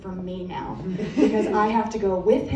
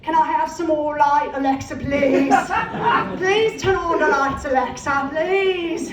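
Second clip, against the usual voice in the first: high, slow, pleading orphan whine; hammed-up Dickens orphan pleading; Oliver Twist begging; 6 semitones higher, 5 dB louder, slower than usual. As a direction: beg like a starving orphan for more. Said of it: "begging in a fucked up Oliver Twist voice".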